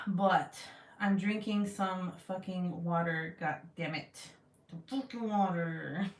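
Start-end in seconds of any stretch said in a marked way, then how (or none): 4.7-6.1 mock-angry. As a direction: growl it with pretend rage. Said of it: "talked like that".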